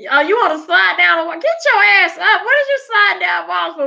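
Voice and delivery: mock furious dad yell; angry parent. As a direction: play the strict parent yelling. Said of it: "my daddy would've came".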